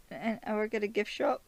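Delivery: high-pitched character voice; Stephen. Acted. Vlogger as Stephen telling Arthur his job, high-pitched voice. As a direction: pitch it up as a naive innocent. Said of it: "Stephen's like".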